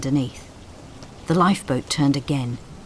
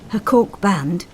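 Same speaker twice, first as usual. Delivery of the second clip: ordinary delivery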